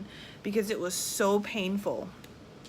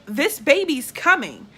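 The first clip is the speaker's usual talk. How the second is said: louder, higher, slow emphatic announcement; retold insistence; 6 semitones higher, 8 dB louder, slower than usual. Mother in labor to staring nurses, retold loud insistence. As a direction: insist forcefully, acting out the moment.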